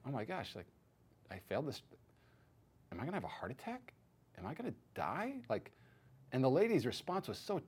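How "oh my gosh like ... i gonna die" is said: very soft, small fearful voice; heart-sunk dread. Retold or imitated. Patient told he failed the stress test, hushed with dread. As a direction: re-enact stunned dread, barely voiced. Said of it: "man, my, my heart sank".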